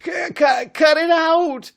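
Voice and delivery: high, loud stammering yelp; screeching in pain. A narrator's reading. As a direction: cry out as your wrist twists. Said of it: "Muggs screeched with pain".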